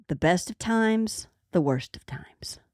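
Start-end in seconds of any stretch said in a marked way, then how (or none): 1.5-2.6 dramatic whisper of a title. Acted. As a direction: drop to a spooky whisper.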